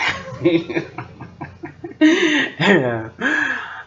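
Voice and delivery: long real laugh; drawn-out amused laughing; laughter. Real, not acted. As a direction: laugh at length after your own remark. Laughs after preacher boy remark.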